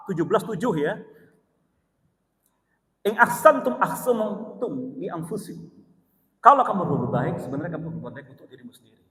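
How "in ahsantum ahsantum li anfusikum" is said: Quran recitation. Acted.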